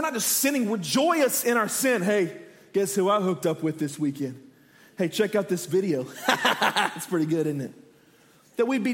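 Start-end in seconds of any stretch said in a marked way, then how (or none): 2.0-6.1 lower, mock-boastful buddy voice; mock bragging. Acts out someone bragging about sin. 6.1-6.9 put-on chuckle of a bragging pal; mock gloating laugh. Acts out laughing over the video. 6.9-7.7 low, mock-smug buddy aside; mock bragging. Acts out someone bragging about sin.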